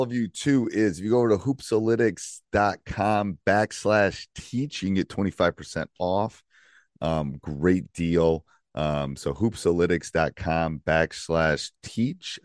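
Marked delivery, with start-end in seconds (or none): 1.4-12.4 deliberate, slow promo read; slow, careful URL read. Shown as a demonstration. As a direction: spell out the web address slowly. Spelling out the discount URL.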